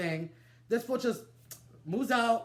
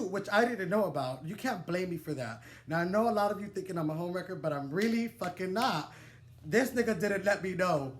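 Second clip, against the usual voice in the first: ordinary delivery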